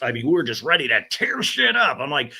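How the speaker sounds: ordinary talk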